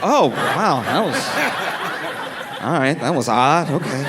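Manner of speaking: laughing, baffled at nothing; half-laughing disbelief; bemused laugh. Retold or imitated. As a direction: chuckle through a puzzled oh wow. Bewildered reaction after not tripping.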